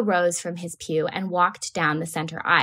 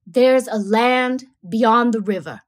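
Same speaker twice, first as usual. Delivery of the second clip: rumble of artillery; 5 dB louder, slower than usual. A narrator's reading. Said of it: "a voice like the rumble of distant artillery".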